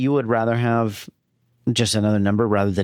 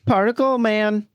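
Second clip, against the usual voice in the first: slow, higher bad child actor; 11 semitones higher than usual. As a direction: stiff line reading like a child actor. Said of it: "Just a bad child actor".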